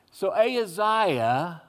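normal talking voice

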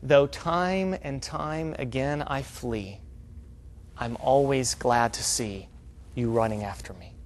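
slow, tender quote, dying away; quoting Gomer. Acted. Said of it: "talking as Gomer".

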